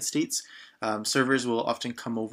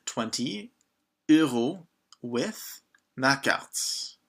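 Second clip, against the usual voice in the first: very slow, drawn-out words; slow, careful teaching read; slow model phrase; much slower than usual. Shown as a demonstration. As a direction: stretch each word out for learners. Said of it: "teacher models the order slowly".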